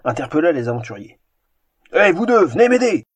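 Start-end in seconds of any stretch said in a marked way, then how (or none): 1.9-3.0 loud, high ogre calling out; cavernous ogre voice. Acted. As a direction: shout for help as the ogre. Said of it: "La voix caverneuse de l'ogre".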